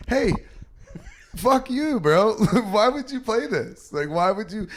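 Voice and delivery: high-pitched, comic exasperation; mock-outraged complaint to a parent; retold indignant outburst. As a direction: protest in comic disbelief, pitched well up. Retells confronting mom over sad movie.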